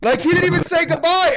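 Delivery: high, heated protest; indignant rant. Real, not acted. Fan telling co-host about snubbed goodbye, indignant. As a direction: hammer the grievance in outrage. Insisting on the snub.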